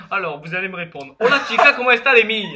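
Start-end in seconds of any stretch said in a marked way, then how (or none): 1.2-2.5 hammed Spanish. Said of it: "je veux réviser un dialogue en espagnol".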